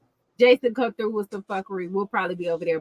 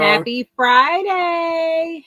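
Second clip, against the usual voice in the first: slow, higher, louder happy greeting; drawn-out greeting; 7 semitones higher, 5 dB louder, much slower than usual. Real, not acted. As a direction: stretch it out to hype the chat. Stream greeting, repeated.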